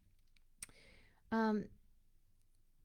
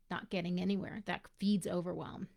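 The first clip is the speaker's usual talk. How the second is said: usual speaking voice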